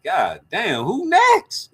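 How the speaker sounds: mock alarm. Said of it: "I was like".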